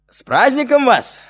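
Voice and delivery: loud, high festive greeting called down the hall; shouting. Acted. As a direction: call out to the hostess. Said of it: "крикнул Колька".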